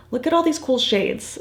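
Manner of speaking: ordinary talk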